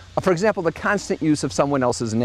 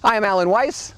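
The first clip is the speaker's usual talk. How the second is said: usual speaking voice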